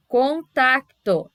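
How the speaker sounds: "contacto" drawn out as con-tac-to, slowly; syllable by syllable. Shown as a demonstration. Teacher showing students where consonants separate, syllable by syllable. Shows how the word divides.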